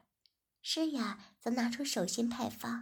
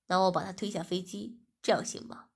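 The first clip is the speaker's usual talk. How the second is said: lower, steady man's voice for 文轩; male lead's voice; 4 semitones lower, 4 dB louder than usual. Acted. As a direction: speak as the calm young boss. Narrator voices male lead. (低沉的男主角声音)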